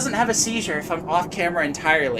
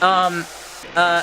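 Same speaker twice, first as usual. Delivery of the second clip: ordinary delivery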